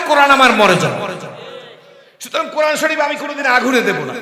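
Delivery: shouted, high, passionate vow; preacher's roaring vow; fervent declaration. Retold or imitated. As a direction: proclaim love for the Quran at full force.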